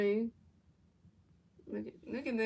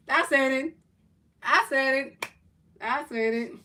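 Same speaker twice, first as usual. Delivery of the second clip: loud, high triumphant shout, easing off; gloating shout; 4 semitones higher, 8 dB louder than usual. Real, not acted. Commentator whose prediction just came true, shouting in triumph. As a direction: shout in vindication, each repeat less forceful.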